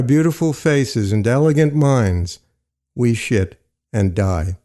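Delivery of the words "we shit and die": ordinary delivery, nothing marked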